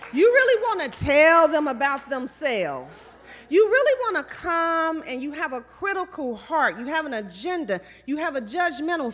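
preaching build. Acted. Preaching at rising force.

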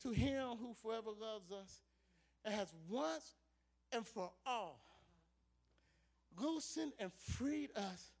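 solemn scripture reading. Acted. Pastor reading the doxology to the congregation, soft and solemn. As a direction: read the blessing with quiet awe.

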